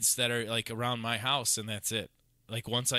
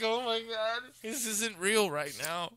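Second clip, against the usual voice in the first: laughing disbelief; 10 semitones higher than usual. Real, not acted.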